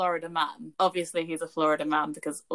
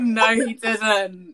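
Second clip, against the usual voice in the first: loud, high, drawn-out laughing protest; slow, cracked-up "no way"; laughing disbelief; 5 semitones higher, 7 dB louder, much slower than usual. Real, not acted. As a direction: refuse to believe it through real laughter.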